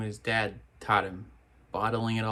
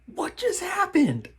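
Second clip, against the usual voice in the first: high, drawn-out shocked question; can't believe what you just saw; slow gasping disbelief; stunned disbelief; 4 dB louder than usual. Real, not acted. Shocked at scene on screen.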